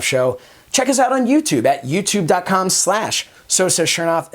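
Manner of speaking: projected announcer read with punched key words; show host sign-off. Acted. Host thanking viewers and plugging other shows, polished announcer sign-off. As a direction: wrap up the show like a TV presenter.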